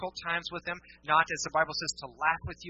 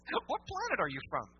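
ordinary delivery

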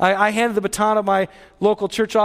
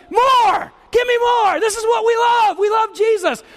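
excited shouting; 10 semitones higher, slower than usual. Retold or imitated. Congregant cheering for more of Jesus, excited shout. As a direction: shout like a hungry, thrilled believer. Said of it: "You're going".

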